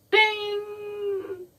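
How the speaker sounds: very high squeaky ding; mock bell ding. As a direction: chirp it like a bell. Sound effect imitated.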